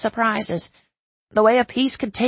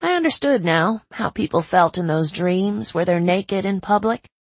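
plain reading voice

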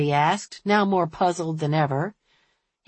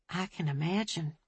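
soft and hushed; quiet; 10 dB quieter than usual. A narrator's reading. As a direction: say it barely above a breath. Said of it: "Ophelia said quietly".

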